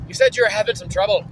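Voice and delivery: normal talking voice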